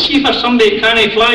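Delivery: high-pitched parrot wisecrack; a hint of a smug parrot; cheeky parrot. Parrot to passenger thrown out the aeroplane, cheeky. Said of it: "Parrot says".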